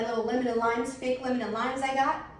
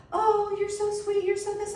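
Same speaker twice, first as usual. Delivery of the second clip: flirty fake sweetness; 10 semitones higher than usual. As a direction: coo like she's flirting. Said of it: "she was like".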